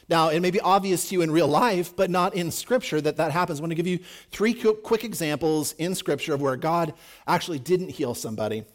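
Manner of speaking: ordinary talk, nothing marked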